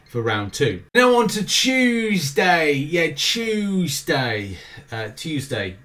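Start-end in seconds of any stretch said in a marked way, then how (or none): 0.9-2.7 drawn-out, posh British Tuesday, much higher pitch; overly British Tuesday. Pretend. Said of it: "I just say it in an overly British way". 2.7-4.6 Tuesday stretched very slowly in an exaggerated British accent; milk the word Tuesday, British and slow; overly British Tuesday. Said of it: "I just say it in an overly British way".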